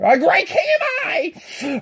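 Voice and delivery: slow, high, loud royal boast; proud shout. Acted. The king on his high stack calls out his greatness, slow shout. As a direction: trumpet it to the pond. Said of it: "saying over and over".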